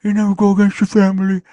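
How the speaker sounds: high, put-on Brando mumble with stuffed cheeks; cheeks-stuffed mock mafia don; Godfather voice. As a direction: imitate the Godfather as if cheeks are full. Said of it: "Marlon Brando had that Godfather voice where his cheeks were all stuffed up".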